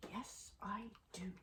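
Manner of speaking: breathy little whisper as the dog. Pretend. Owner voices her dog's eager answer, whispered. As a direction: hush it out like the dog agreeing.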